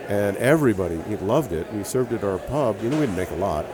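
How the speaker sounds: usual speaking voice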